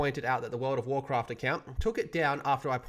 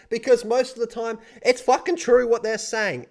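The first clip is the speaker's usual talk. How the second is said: louder and higher, fired-up outburst; heated rant; 7 semitones higher, 8 dB louder than usual. Real, not acted. Vlogger ranting to viewers about Blizzard's guilt, loud and fired up. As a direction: let it burst out, higher and louder.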